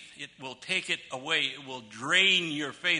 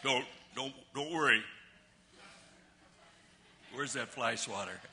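tag-down dad; 4 semitones lower, 7 dB quieter than usual. Retold or imitated. Plays dad backing down from spider.

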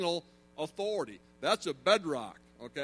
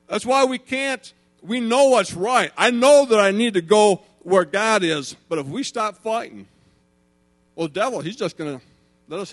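loud, raised-pitch pulpit cry; impassioned preaching; 3 semitones higher, 12 dB louder than usual. Real, not acted. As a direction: drive the point home to the congregation at full force.

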